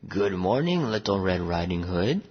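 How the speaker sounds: low gruff wolf, lightly voiced. A narrator's reading. Wolf greeting the girl from behind, gruff voice. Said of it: "a gruff voice said".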